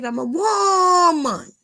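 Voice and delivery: high, loud, drawn-out mock wail; hammed-up cry of I'm a woman; dramatic wail. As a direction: wail it like a wounded diva. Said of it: "mock dramatic outburst about herself".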